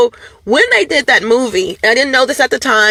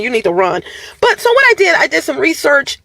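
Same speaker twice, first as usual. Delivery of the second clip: ordinary delivery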